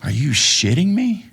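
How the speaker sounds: can't believe it; incredulous. Retold or imitated. Reacting to being told to fetch coffee, incredulous.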